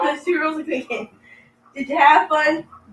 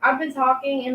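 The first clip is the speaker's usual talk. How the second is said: normal talking voice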